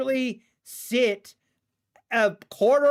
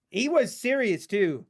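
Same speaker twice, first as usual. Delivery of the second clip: usual speaking voice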